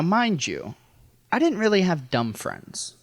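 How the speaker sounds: ordinary delivery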